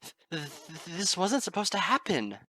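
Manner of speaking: stuttering, then high and loud; sobbing stammer. Acted. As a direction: choke on the words through sobs.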